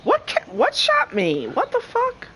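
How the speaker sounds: high-pitched, bewildered yelp; shocked, voice shooting way up; startled confusion. Real, not acted. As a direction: squeal out the question in alarm. Shot by something unseen.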